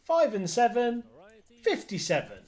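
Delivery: loud, high bingo number call; bingo caller. Acted. Bingo calling on stream.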